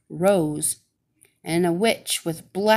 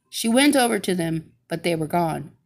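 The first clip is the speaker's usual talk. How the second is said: ordinary delivery, nothing marked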